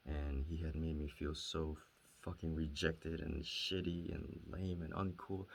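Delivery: ordinary talk, nothing marked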